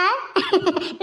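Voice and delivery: short teasing laugh; mocking laugh. Woman taunting her angry jail mate, mocking laugh. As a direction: laugh right in her face. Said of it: "ನಗಬೇಡ ನೀನು".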